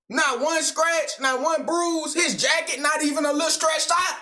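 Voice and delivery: high, emphatic exasperated rant; heated indignation. Real, not acted. Viewer arguing the attacker left without a scratch, heated and incredulous. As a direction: argue it hard, piling up each point. Reactor heatedly argues about the fight.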